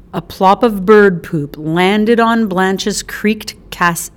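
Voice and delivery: ordinary delivery, nothing marked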